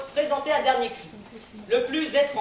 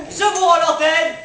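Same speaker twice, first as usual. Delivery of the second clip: ordinary talk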